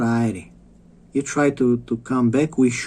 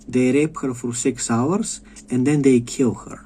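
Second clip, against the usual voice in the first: ordinary talk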